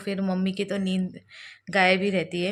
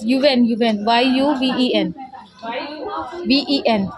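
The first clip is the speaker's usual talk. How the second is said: loud, slow, letter by letter; spelling it out loud; 3 semitones higher, 6 dB louder, slower than usual. Real, not acted. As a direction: spell the name clearly over the noise. Spelling child's name at hospital counter.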